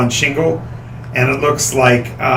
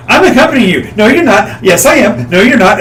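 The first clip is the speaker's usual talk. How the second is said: bickering; 7 semitones higher, 6 dB louder than usual. Retold or imitated. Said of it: "the two of you are arguing".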